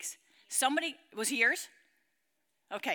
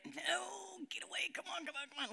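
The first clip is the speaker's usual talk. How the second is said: hushed, urgent whisper; Satan's pull; 5 semitones higher, 11 dB quieter than usual. Acted. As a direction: whisper it softly, coaxing and pulling. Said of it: "like this".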